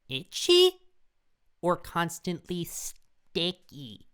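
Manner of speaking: very high, slow, squeaky voice; mock falsetto reading; silly squeaky voice. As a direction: squeak the question out like a cartoon. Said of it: "repeats question in silly voice".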